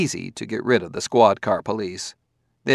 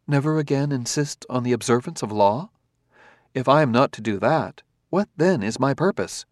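higher, even, polite robot; calm robot voice; 4 semitones higher than usual. Acted. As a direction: speak as a courteous, precise android. Robot character held voice.

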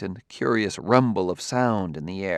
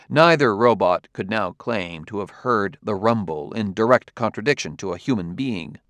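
ordinary delivery, nothing marked

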